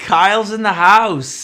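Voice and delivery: welcoming the guest with a hype call; hype announcement. Real, not acted. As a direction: announce him like a ring entrance. Host announcing special guest.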